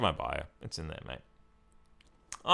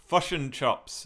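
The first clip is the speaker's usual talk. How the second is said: higher New Zealand "fish and chops", vowels shifted to "fush and chops"; New Zealand vowels, pitched up; New Zealand accent; 5 semitones higher, slower than usual. Shown as a demonstration. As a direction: say it like a Kiwi, short I turned to UH. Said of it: "New Zealand accent is the same as the Australian accent, except they say".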